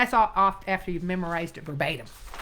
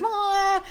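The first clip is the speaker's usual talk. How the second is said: sharp high yelp like an alarm; cowbell alarm; 12 semitones higher, 5 dB louder than usual. Retold or imitated. Teacher mimics her inner alarm at paraphrasing, sharp yelp. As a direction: yelp like a bell going off. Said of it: "it goes".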